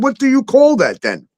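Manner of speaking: high, exasperated disbelief; mock outrage at a silly rule; retold outrage. Retelling the TikTok ban to a friend, high and exasperated. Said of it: "I said".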